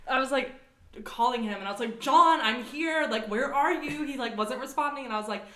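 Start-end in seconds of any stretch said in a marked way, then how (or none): 2.0-4.0 slow, loud call of John's name through the door. Retold or imitated. Friend at John's door, calling out slowly and loudly.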